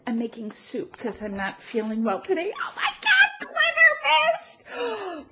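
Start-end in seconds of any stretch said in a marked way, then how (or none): 2.6-5.3 sky-high squeal, stretched at the end; ecstatic squeal. Real, not acted. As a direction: squeal it, overwhelmed with delight. Finding more of the gift.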